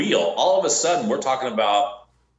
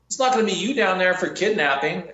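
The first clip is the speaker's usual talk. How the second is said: higher, pointed comeback; emphatic retort; 6 semitones higher than usual. Retold or imitated. Bounty hunter retorts to the bondsman, pointed and higher. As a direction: fire it back, higher. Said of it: "I'm like".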